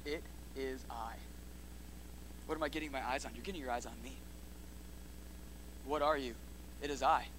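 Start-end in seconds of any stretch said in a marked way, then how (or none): 0.1-1.2 hushed. Retold or imitated. Quiet quote of Jesus.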